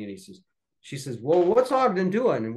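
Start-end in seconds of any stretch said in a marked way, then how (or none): 1.2-2.5 lightly voiced bossy wife, higher and louder; a hint of a demanding wife butting in. Second wife bosses her husband about the photo, mildly bossy. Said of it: "kind of bossing him around".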